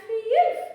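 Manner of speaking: loud high sigh of relief; relieved phew. Acted. Frog relieved the dragon is gone, a loud high phew. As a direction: blow out relief after the scare. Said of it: "said the frog".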